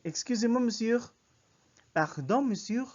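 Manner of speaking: high, loud French call with lifted ends; getting attention. Shown as a demonstration. Teacher demonstrates stopping a friend walking ahead, high loud call. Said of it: "you want them to stop".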